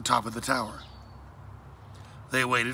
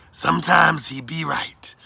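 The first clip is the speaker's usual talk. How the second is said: slow, loud, higher pirate voice; pirate mate agreeing; 5 semitones higher, 7 dB louder, slower than usual. A narrator's reading. Mister Owens backing up Blackbird, slow pirate drawl. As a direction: agree slowly and loudly in character.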